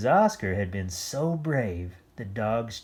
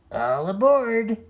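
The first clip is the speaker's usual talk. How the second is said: high, loud, slow call; cheery call; 12 semitones higher, 10 dB louder than usual. A narrator's reading. Oscar calling to the dogs, high and loud. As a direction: call everyone aboard. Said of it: "Oscar called".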